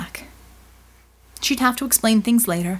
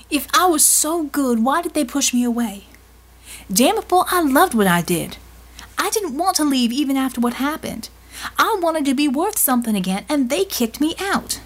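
higher-pitched, anguished protest; upset, high-pitched venting; hurt, frustrated outburst; 5 semitones higher than usual. Acted.

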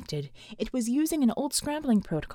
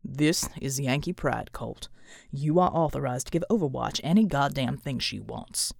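stern authority; 8 semitones lower than usual. A narrator's reading. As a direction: give the order in a deep, measured voice. Pride's frustrated order to Colt.

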